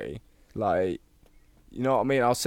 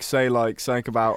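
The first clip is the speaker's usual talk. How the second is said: ordinary talk, nothing marked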